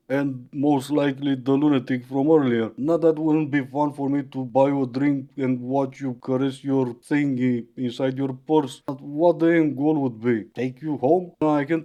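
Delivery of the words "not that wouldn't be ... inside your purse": ordinary delivery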